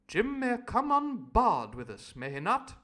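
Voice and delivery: high loud slow plummy ask; posh doctor. Acted. Doctor Livesey asking about Jim, high and slow. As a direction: ask grandly. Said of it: "added the Doctor".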